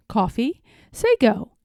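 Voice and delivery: ordinary delivery, nothing marked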